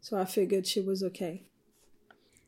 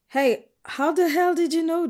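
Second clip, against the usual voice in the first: astonished; 8 semitones higher, 5 dB louder than usual. Acted. Astonished question to friend.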